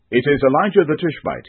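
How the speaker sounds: suddenly realising who it was, calling it out; exclaiming. A narrator's reading. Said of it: "he exclaimed".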